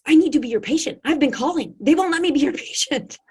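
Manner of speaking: high, loud, eager pleading; a fan begging to be taken on; pleading woman. Retold or imitated. Fan begs the doctor to take her as patient, high and loud.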